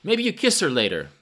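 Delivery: high, loud teasing cry; joyous shout. A narrator's reading. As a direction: crow it merrily to the crowd. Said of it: "the balding man shouted joyously".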